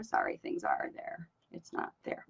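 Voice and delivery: hushed off-mic aside; whispering. Real, not acted. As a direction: whisper it under your breath. Off-mic aside during live stream.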